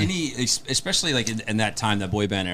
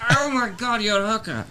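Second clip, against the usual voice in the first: very high, laughing English impression; hammed-up tipsy-film-star English squeal; Dudley Moore; 10 semitones higher than usual. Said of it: "So it's only Dudley Moore".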